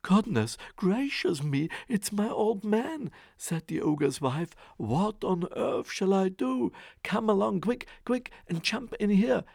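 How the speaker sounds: high, flustered panic; panicked wife. Acted. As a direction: hurry the boy into hiding. Wife panics as ogre arrives.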